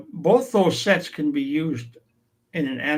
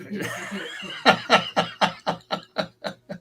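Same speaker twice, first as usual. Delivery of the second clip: soft laughter; 4 semitones higher, 4 dB quieter than usual. Real, not acted. Elders laughing at the joke.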